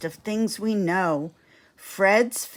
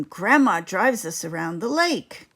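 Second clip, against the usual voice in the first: usual speaking voice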